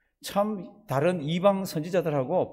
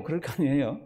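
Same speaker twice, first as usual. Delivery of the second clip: ordinary delivery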